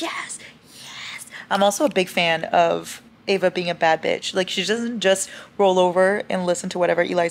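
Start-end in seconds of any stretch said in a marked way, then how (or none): 0.0-1.3 hushed, breathless yes, yes; whispered yes. Retold or imitated. Reader cheering on a loyal character, whispered. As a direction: whisper your approval like a fangirl. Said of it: "I'm like".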